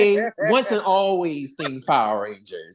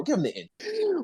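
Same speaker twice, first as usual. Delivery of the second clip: high mock repeat; 10 semitones higher, 6 dB quieter than usual.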